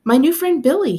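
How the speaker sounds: put-on high and loud, proud child's voice; excited little boy. A narrator's reading. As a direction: announce your new friend with glee. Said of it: "he said".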